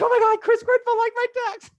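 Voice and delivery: giddy high squeal; starstruck squeal. Acted. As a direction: shriek it like a thrilled seventh grader. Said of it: "I'm in seventh grade".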